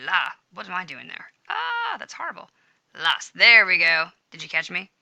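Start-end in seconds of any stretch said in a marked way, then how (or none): 1.5-2.5 high-pitched "ah" of dismay; dismayed at own slip. Real, not acted. Teacher catches a mistake in the example, dismayed. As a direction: cry out high at your own blunder.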